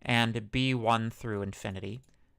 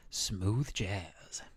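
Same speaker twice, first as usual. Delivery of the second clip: smooth jazz DJ; 3 semitones lower, 4 dB quieter than usual. Pretend. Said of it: "I sound like a jazz radio station D J".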